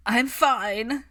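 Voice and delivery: high, loud after a coughing fit; choked reassurance. A narrator's reading. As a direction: wave them off, still spluttering. Padma after choking on drink.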